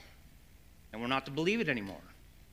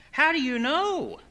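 very high, louder challenge; mock incredulous; 13 semitones higher, 6 dB louder than usual. Father challenging his daughter's verdict, mock incredulous. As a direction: act out baffled protest, pitch leaping up.

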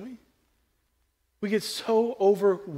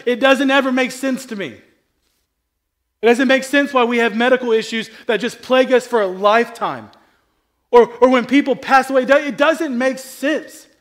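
impassioned, louder, higher preaching; preaching at full force; 8 semitones higher, 10 dB louder than usual. Real, not acted. Pastor preaching passionately to congregation about suffering. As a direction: build the passion, pressing every line.

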